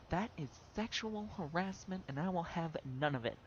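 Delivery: high-pitched, outraged grumpy-lady impression; mock indignation. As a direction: imitate an affronted customer laying down the law. Retold woman indignantly accusing an officer.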